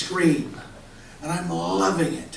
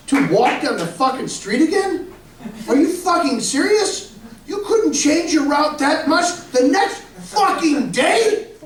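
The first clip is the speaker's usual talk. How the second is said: incredulous rant; 10 semitones higher than usual. Retold or imitated. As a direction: rant at the thief like you can't believe the nerve. Ranting at the bike thief.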